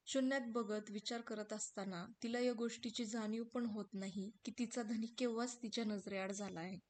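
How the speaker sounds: ordinary delivery, nothing marked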